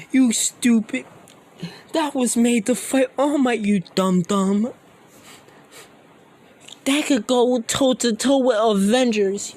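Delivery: high, loud, creepy villain voice; Shigaraki gloating. Acted. Villain boasting about his monster, high-pitched gloating. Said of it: "Shigaraki starts laughing".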